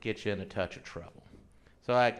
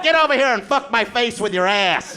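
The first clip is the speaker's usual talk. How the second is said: loud, high, brash read; crude demand; 10 semitones higher, 8 dB louder than usual. Retold or imitated. Comic quoting a crude app message, loud and high. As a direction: bark it like a filthy dating message. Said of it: "It's just like".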